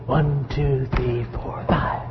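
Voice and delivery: low, soft, mechanical counting; engineer counting. Retold or imitated. Preacher mimicking a step-by-step engineer mind, low and soft. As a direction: tick off numbers like a machine, quietly. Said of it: "They don't think".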